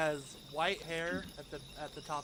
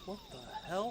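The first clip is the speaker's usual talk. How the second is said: a touch of surprise; 9 semitones higher, slower than usual. Acted. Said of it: "be like".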